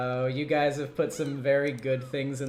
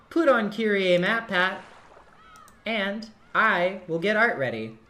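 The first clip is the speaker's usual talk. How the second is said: slow loud announcement; 7 semitones higher, 7 dB louder than usual. Pretend.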